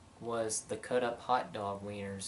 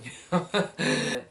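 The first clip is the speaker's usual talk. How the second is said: laughing; 5 semitones higher, 4 dB louder than usual. Real, not acted. Laugh after impression.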